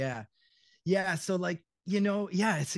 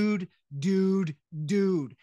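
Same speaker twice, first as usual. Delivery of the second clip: loud, drawn-out surfer dude; stoner surfer; 3 semitones higher, 7 dB louder, much slower than usual. Acted. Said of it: "this stoner surfer thing like Jude".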